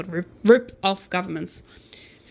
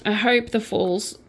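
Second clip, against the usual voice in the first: usual speaking voice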